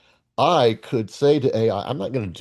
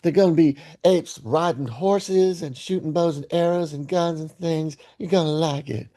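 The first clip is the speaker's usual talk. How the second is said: higher, lightly put-on parent voice; imitating parents; 6 semitones higher than usual. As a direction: sell the movie to a five-year-old. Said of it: "They said, they said".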